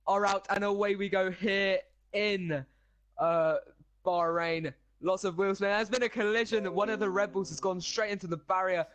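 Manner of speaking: loud, high, excited play-by-play; race commentator. Acted. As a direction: call the race start like live TV.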